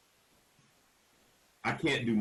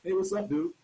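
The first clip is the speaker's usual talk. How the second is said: higher, breezy easygoing cop voice; mock laid-back cop; 10 semitones higher than usual. Officer greeting the driver at the window, mock-casual and higher. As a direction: play the officer as all chill and friendly. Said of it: "he's really a calm guy".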